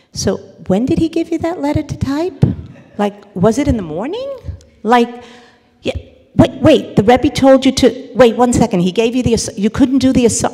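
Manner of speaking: loud, high, rapid-fire questions; interrogating friend. Retold or imitated. Friend grilling the upset woman with questions, loud and high. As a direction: pepper her with questions. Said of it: "the friend starts with the questions".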